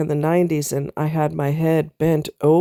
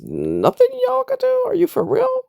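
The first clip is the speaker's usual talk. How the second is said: incredulous disbelief. Retold or imitated. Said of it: "and I'm like".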